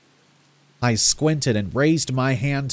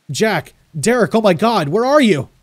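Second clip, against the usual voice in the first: loud, high, panicked calling out; desperate shouting search; crying out desperately; 8 semitones higher, 6 dB louder than usual. A narrator's reading. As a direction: scream for your lost friends, half delirious. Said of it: "I cried, nearly delirious".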